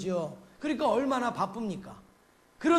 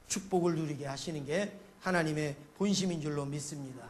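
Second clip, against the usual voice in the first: ordinary delivery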